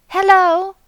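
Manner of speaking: high, loud call into the jungle; cried out. A narrator's reading. Lost clerk calling to an unseen voice, loud cry. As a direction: call out hopefully to a stranger. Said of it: "he cried".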